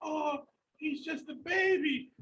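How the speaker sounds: high, slow cooing voice; cooing baby talk. Retold or imitated. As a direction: coo it like doting on a baby. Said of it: "you know".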